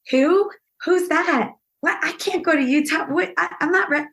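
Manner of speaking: very high, bewildered stammering; retold flustered disbelief. Actress reacting to the casting call, flustered and high-pitched. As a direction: replay a panicked refusal, voice shooting up. Acts out flustered reply to offer.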